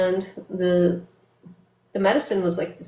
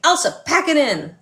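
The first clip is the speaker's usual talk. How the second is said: mock stern order; 8 semitones higher, 4 dB louder than usual. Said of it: "She's like".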